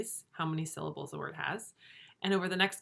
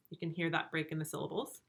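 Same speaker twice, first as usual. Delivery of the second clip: normal talking voice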